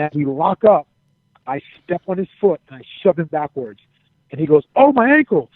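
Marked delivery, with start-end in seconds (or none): 4.7-5.5 high, loud, hammed-up yelp of pain; mock pained cry. Wrestler shoved in the ring faking injury, mock pained cry. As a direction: cry out like a badly faked injury. Friend's fake injury acted out.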